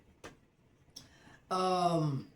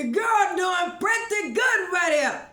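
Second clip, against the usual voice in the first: much higher, louder proud boast; a high, loud show-off brag; excited brag; 12 semitones higher, 8 dB louder than usual. Real, not acted. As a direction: crow about how good it looks. Bragging about her piping.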